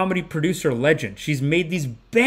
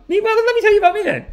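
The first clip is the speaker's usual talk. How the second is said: very high, louder mock-producer voice; imitated eager producer; 5 dB louder than usual. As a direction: leap up high, hamming up her eagerness. Acting out producer's showy pitch.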